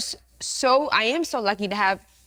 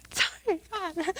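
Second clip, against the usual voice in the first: emotional; 8 semitones higher, 5 dB quieter, slower than usual. Real, not acted. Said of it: "Sorry, I'm so emotional".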